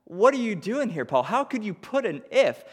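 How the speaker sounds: high, startled protest at Paul; mock shock, pitched well up; surprised disbelief. Listener reacting to Paul's if, high and surprised. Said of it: "and you're like".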